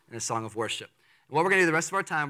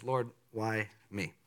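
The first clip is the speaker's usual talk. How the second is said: soft humble prayer; 6 semitones lower, 8 dB quieter, slower than usual. Shown as a demonstration. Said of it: "have that kind of response".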